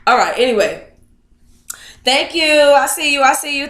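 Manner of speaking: ordinary talk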